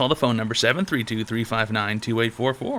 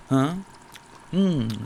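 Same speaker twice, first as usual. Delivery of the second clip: usual speaking voice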